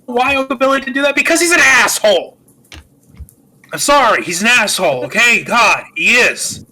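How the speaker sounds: loud, high outburst of dislike; exasperated rant. Real, not acted. Host venting about a man they hate, exasperated.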